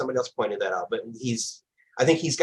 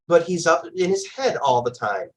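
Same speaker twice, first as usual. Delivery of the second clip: ordinary talk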